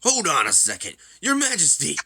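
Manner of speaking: loud, high outburst to a ruler; urgent shouted interruption; alarmed objection. Acted. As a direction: cry out in protest, pitch leaping.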